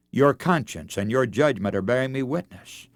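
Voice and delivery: normal talking voice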